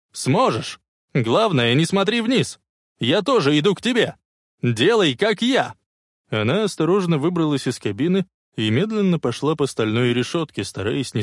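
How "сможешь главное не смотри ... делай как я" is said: raised, urging voice; shouted encouragement. A narrator's reading. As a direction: call out to steady someone's nerve. Hero calls encouragement across train. (ободряющий крик)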